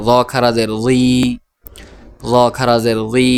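closing রি held long; one-alif stretch. Shown as a demonstration. Teacher models khara zer, final রি stretched. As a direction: spell it evenly, then draw out the last রি. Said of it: "খারা জেরের উচ্চারণ".

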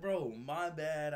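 sheepish apology. Retold or imitated. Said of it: "I was like".

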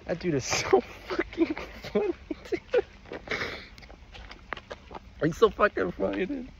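high, helpless laughing through the words; real laughter breaking up high-pitched speech; genuine laughter. Real, not acted. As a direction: crack up while gushing about someone. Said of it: "Genuine laughter".

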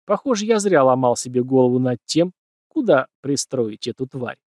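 ordinary delivery

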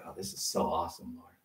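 mock the idea that prison felt fun; mock cheerful. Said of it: "I'm sure they're not sitting there like".

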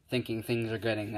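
plain reading voice

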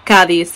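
Cádiz said KAH-deez, first syllable stressed; 把Cádiz的重音放在第一个音节. Shown as a demonstration. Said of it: "the emphasis is on the first".

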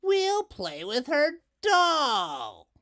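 put-on slow, high, gloating child's taunt; taunting Doris. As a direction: drag out the threat like a bully. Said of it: "said Doris".

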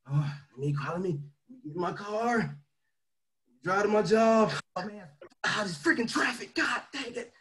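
a grumbling nine-to-five worker stuck in traffic; grumbling commuter. Retold or imitated. Mocking the office worker stuck in traffic, lightly grumbled. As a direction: mutter and sigh like a fed-up employee.

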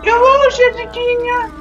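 high cutesy voice, English; sing-song greeting. Pretend. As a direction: coo a sweet hello to a friend.

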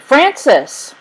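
high, loud name call; shouting. A narrator's reading. Boy reunited with his brother, shouting. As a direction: cry the name out across the crowd. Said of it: "John shouted".

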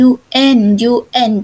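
"un" said very slowly as a model; teacher slowly modelling the "un" sound; drawn-out demo of un. Shown as a demonstration. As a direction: stretch out "un un" for the class. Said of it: "จะมีคำยังไง".

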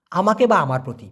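normal talking voice

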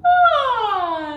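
high, loud "ah" sliding down; sliding yawn sigh. Shown as a demonstration. Voice teacher demonstrating a sliding yawn. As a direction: sigh it from the top and slide down. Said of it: "and then slide down".

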